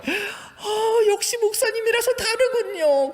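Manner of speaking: high, loud booming auntie voice; booming Jeolla lady. Retold or imitated. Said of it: "쩌렁쩌렁하게". (높고 큰 쩌렁쩌렁한 아주머니 목소리)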